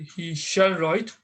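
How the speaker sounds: "He shall write" said slowly, each word weighted; slow emphatic. Shown as a demonstration. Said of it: "But if you say".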